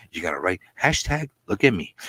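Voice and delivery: usual speaking voice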